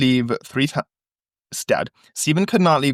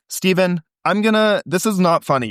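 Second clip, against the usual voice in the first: higher-pitched, exasperated telling-off; annoyed warning; 7 semitones higher than usual. Acted. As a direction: act out scolding a prankster. [name] scolds the suspected prankster.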